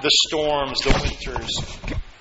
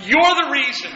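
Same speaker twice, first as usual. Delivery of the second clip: fervent praise; 8 semitones higher than usual. Shown as a demonstration. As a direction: lead the room in lifting thanks to God. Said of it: "Just say".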